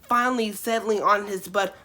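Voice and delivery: normal talking voice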